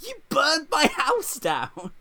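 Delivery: high, loud, drawn-out imitation; mock outraged cry. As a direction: wail it as a hammed-up accusation. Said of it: "And she's like".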